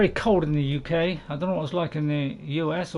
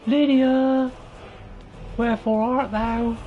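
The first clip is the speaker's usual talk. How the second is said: raised, stagey calling voice; mock-theatrical call; 3 semitones higher, slower than usual. Player seeking the companion, mock-theatrical. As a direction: call out like a hammed-up stage lover.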